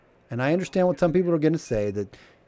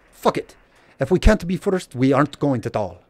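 curt mock Russian accent, a bit louder; 4 dB louder than usual. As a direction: dismiss it bluntly in a hammed-up Russian accent. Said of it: "That's my Russian accent".

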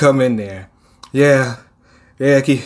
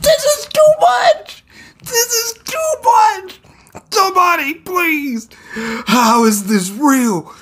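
very high, slow, tearful wail; crying wail; slower than usual. Acted. Rescuer overwhelmed by destruction, slow high sobbing wail. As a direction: sob it out, overwhelmed.

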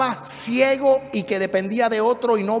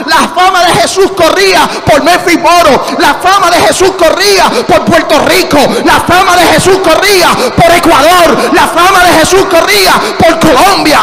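loud, high revival shout; fiery pulpit proclamation; full-force preaching; 7 semitones higher, 12 dB louder than usual. Real, not acted. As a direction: thunder it out in rolling, rhythmic cries.

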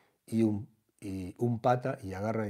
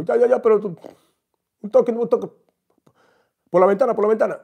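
very high, loud whiny bargaining; high whiny giving in; 9 semitones higher, 10 dB louder, faster than usual. Retold or imitated. Celebrity bargaining with the teller, high and whiny. Celebrity gives in, window only.

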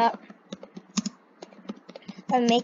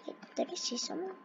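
whisper; 2 semitones higher, 17 dB quieter, much faster than usual. Real, not acted. Sneaking up while playing hide and seek.

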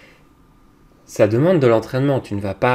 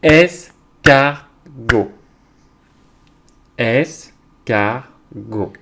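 Escargot very slowly in three beats, es, car, got; syllable by syllable; 7 dB louder, much slower than usual. Shown as a demonstration. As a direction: beat out each syllable of Escargot. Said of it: "frapper les syllabes pour les séparer".

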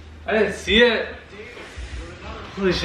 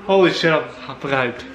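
usual speaking voice

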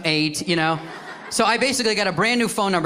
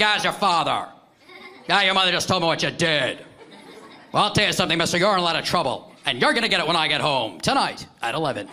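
dad scolding like an evening news anchor; newscaster cadence. Retold or imitated. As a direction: deliver the threat as a breaking news report. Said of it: "in that newscaster cadence".

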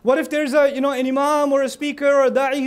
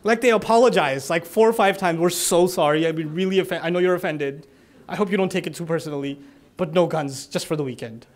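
mock apologetic sign; 7 semitones lower than usual. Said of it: "actual signs in Texas".

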